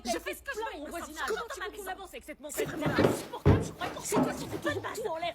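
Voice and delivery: high-pitched angry arguing; heated quarrel. Acted. Parents argue in the background.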